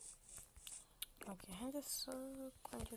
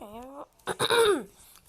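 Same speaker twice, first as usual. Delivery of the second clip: loud, forceful throat clear; 6 semitones higher, 16 dB louder than usual. Real, not acted. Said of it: "un pic răgușit". (dres zgomotos al vocii)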